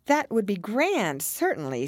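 mildly musing, starting high; thoughtful. A narrator's reading. Alice considering the Hatter's idea, thoughtful. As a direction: weigh it up as you speak. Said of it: "said Alice thoughtfully".

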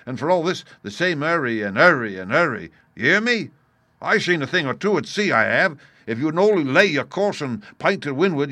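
high, loud, scornful tirade; haranguing pirate leader. A narrator's reading. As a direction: lecture the crew with loud contempt. Silver's harangue continues.

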